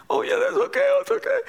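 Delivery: tiny, very high-pitched mock voice; high falsetto imitation of agreeable daughters; imitated tearful daughters. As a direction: squeak it out as the daughters. Voices the crying daughters replying.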